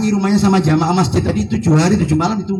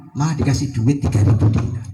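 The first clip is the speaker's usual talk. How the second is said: normal talking voice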